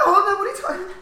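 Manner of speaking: high, loud, laughing protest; laughing disbelief. Retold or imitated. Reacting to the Superbad scene, high loud laughing shout. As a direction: shriek it through laughter. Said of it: "Now I'm like".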